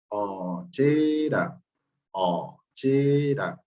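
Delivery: "ochera" drawn out slowly, syllable by syllable; slow model pronunciation. Shown as a demonstration. Teacher modelling "ochera" for the class, very slow. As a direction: stretch "ochera" out for learners to copy. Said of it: "¿Cómo se pronuncia?".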